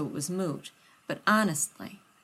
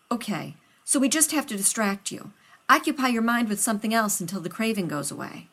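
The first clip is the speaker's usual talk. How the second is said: louder, eager flustered scientist voice; a nervous friend rushing to help; loud and hurried, anxious to please; Rodney, flustered; 4 semitones higher, 6 dB louder than usual. Acted.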